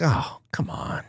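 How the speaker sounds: breathy whisper of mock disapproval; hushed scandalized groan; prim whispered tut. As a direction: tut at him like a prude, barely audible. Mock prim reaction to cursing.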